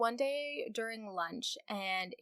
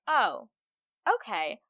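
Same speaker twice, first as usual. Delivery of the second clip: mock sudden agreement; 2 semitones higher, 7 dB louder, slower than usual. Sexist caller finally hears a man say no, mock-surprised giving in.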